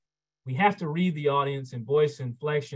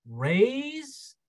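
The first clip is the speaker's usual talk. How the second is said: raised voice; 10 semitones higher than usual. Shown as a demonstration. Lecturer showing how we raise our voice, rising high. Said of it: "or lower our voice".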